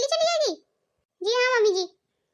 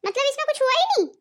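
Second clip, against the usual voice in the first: sarcastic retort; 3 semitones higher than usual. Acted.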